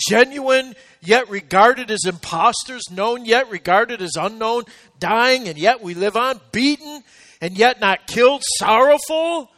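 rising, ringing preacher's cadence building on each word; preaching at full force. Acted. Pastor lifting the church through Paul's hardships, preaching hard. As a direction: preach the list to the congregation, swelling.

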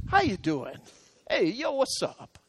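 soft, easygoing, slangy hello; casual greeting. Retold or imitated. Friend drops by the pastor's office in hard times, imitated casual greeting. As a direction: imitate a buddy casually dropping in, keeping it quiet. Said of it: "He came up and said".

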